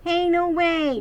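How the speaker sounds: high, loud objection; protest. Acted. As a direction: shout him down, no way. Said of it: "Jasper Junior began to protest".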